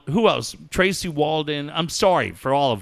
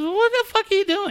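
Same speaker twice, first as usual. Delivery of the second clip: high, fast mock telling-off; mock-outraged, quick and high; imitated scolding; faster than usual. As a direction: retell an exasperated demand in a raised pitch. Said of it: "having your ex-wife yell at you".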